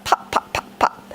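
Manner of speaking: soft unvoiced whisper of popping rivets. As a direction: breathe the pops out as a hushed whisper.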